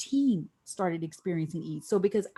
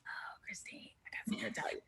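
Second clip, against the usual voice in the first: secretive boss whisper; 17 dB quieter, faster than usual. Retold or imitated. Boss Jason teasing the news, whispering. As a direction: breathe it like a secret.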